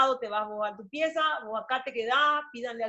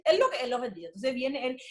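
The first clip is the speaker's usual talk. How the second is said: ordinary talk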